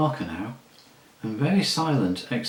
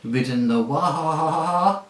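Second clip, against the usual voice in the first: slow, high, louder words dissolving into a whinny; neighing horse; 6 semitones higher, 6 dB louder, much slower than usual. A narrator's reading. Angry talking horse to the boy, neighing the word. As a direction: let the last word turn into a long neigh. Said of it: "it came out in a sort of neigh".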